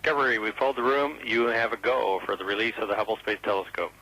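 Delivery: ground control over radio; radio transmission. Real, not acted. Mission control radio call.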